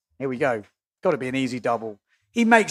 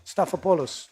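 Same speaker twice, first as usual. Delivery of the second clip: Greek accent; 6 dB quieter than usual. Shown as a demonstration. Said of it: "have to say it in a Greek accent".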